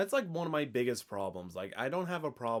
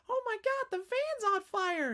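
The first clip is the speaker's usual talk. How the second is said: high panicked shriek. Retold or imitated. Character screams about fire.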